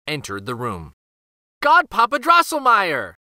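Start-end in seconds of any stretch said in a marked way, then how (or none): 1.6-3.1 put-on high, loud delighted children's greeting; children crying out happily; happy cry. A narrator's reading. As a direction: call out with glee, pitched up. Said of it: "the children cried happily".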